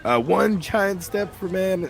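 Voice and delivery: mock moon speech. Quoting Buzz Aldrin line.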